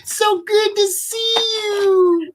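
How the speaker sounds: very high, stretched-out put-on voice; gushing greeting. Greeting Mark in the stream, mock gushing.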